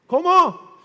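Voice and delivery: high, loud ¿Cómo? in disbelief; mock surprise. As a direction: feign shock at the son's excuse. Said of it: "Le dije".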